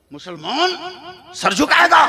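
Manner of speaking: loud, high, outraged question; indignant shout. Real, not acted. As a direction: hurl the question at the crowd. Preacher's rhetorical outburst. (ऊँची आवाज़ में गुस्से भरा सवाल)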